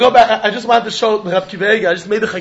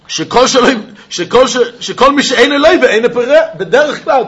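excited quoting; 5 semitones higher, 5 dB louder, much faster than usual. Real, not acted.

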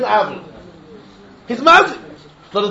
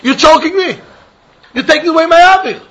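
higher and louder, arguing as the neighbor; raised voice in mock quarrel; 8 semitones higher, 4 dB louder than usual. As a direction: protest as the neighbor whose air is blocked.

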